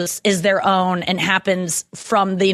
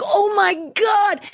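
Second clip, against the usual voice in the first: much higher, slow, drawn-out gasp of disbelief; stunned exclamation; 9 semitones higher, much slower than usual. Retold or imitated. Watching Joe break down on TV, slow and stunned. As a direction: let it fall out of you in shock. Reacting to Joe's raw moment.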